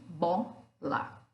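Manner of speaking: bola in two separate pieces, bo... la; bo, pause, la; split into syllables. Shown as a demonstration. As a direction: say bola with a gap between bo and la. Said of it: "Ela se divide em dois pedaços".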